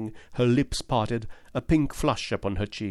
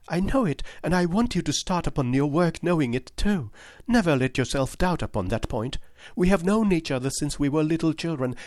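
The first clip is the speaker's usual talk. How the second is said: put-on higher, pleading young lady's voice; earnest young woman; 7 semitones higher than usual. Miss Turner insisting to Holmes that James is innocent, higher earnest voice. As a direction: plead for James with eager conviction.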